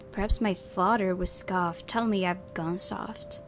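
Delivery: usual speaking voice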